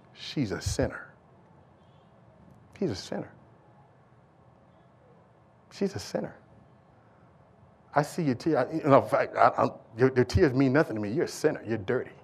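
low, soft, contemptuous; cold Pharisee. Acted. Pharisee dismissing the weeping woman, low cold contempt. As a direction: sneer the word sinner quietly.

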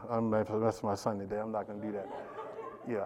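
soft, laughing words to his son; quiet laughing tease; laughing aside. Real, not acted. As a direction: chuckle it under the breath. Teasing his son in the congregation.

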